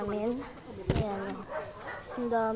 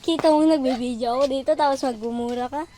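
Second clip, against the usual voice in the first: loud, fast telling-off; exasperated scolding; 8 dB louder, faster than usual. Real, not acted. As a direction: snap at him for swearing on camera. Scolding someone for cursing on video. (pinapagalitan ang kasama dahil nagmumura, malakas at mabilis)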